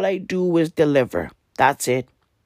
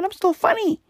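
much higher, strained mock-defiant squeak; squeaky insistence. Telling listeners he's still funny, squeaky and defiant. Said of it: "I'm still delivering the fucking funny".

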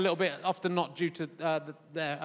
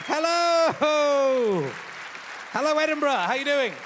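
calling out; 7 semitones higher than usual. Real, not acted. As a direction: project the hello out to the room. Greeting the Edinburgh audience.

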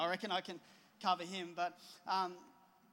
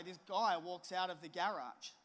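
ordinary talk

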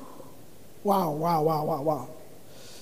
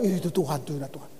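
mocking gullible listener; 7 semitones lower, 8 dB quieter, much faster than usual. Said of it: "Jadi nanti siapa aja yang khotbah".

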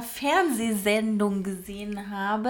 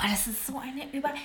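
hushed whisper; 4 semitones higher, 3 dB quieter than usual. Real, not acted. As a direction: breathe it in a whisper. Starts in a whisper.

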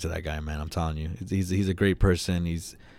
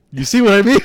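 much higher and louder, vindicated outburst; exasperated David; 11 semitones higher, 11 dB louder than usual. Retold or imitated. David turning to friend after the worker leaves, loud and high. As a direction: burst out loud and high, I told you so.

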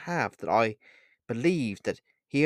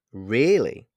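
teasing, louder drawn-out question; playful; 6 dB louder than usual. A narrator's reading. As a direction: toy with them playfully. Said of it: "I asked playfully".

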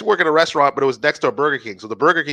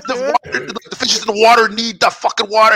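high, raised-voice mock outburst; hammed-up irrational rant, pitched up; retold snapping; 9 semitones higher, 4 dB louder than usual. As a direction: act out losing it on a customer. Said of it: "apparently I snapped".

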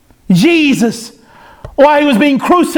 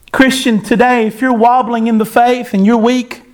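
ordinary delivery

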